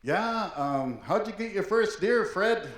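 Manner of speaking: high-pitched put-on inquirer; playfully voiced question; acted questioner. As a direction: pipe up as a curious asker. Acts someone asking about first deer.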